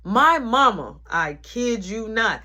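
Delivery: ordinary talk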